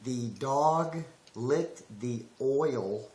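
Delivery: very slow, careful, accentless diction; deliberate word-by-word neutral speech; without an accent. Shown as a demonstration. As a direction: spell out each word with care, southern accent held back. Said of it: "say it without an accent".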